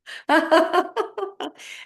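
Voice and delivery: high, rapid staccato laugh; fake laughter. Shown as a demonstration. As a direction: force out rising ha-has while tapping. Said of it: "as you're making laughing sounds".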